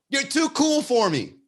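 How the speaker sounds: high-pitched imitated griping; hammed-up frustrated whine; mock exasperated complaint. Voices her complaint about his calm.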